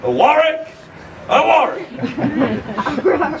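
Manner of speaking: high shouted reply, drawn out; shouted battle cry with a laugh; mock crowd reply shout. Shown as a demonstration. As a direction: show the crowd how to answer. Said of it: "You will reply".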